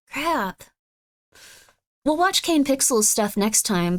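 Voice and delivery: ordinary talk, nothing marked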